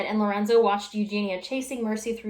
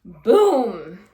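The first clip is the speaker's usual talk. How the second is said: loud boom; 9 dB louder than usual. A narrator's reading. Bed crashes through floor.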